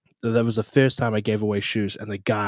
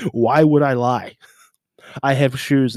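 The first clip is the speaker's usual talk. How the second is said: excited; 4 semitones higher, 6 dB louder than usual. Retold or imitated. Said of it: "I'm like".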